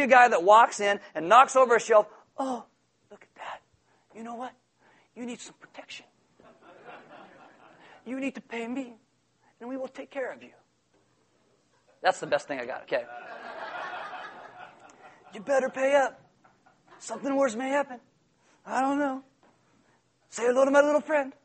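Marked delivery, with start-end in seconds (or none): 2.4-10.5 very soft, hushed shakedown voice; menacing mobster. Acted. Shakedown man threatening a shop owner, quiet menace. 15.3-21.3 lightly voiced racketeer, higher pitch; a hint of veiled gangster threat; menacing mobster. Shakedown man warning a shop owner to pay, mock menace.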